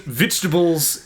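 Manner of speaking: "vegetables" with New Zealand vowels; New Zealand accent. Shown as a demonstration. Showing co-host the word, New Zealand accent. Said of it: "with a New Zealand accent sounds like victuals".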